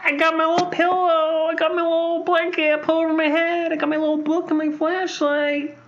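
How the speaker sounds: put-on high little kid voice; tiny kid sneaking a book; sleepy child. Playing a little kid reading.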